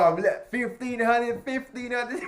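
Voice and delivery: high, drawn-out gleeful whoop; hyped-up excitement. Retold or imitated. Driving with [name] on loud, hyped-up excitement.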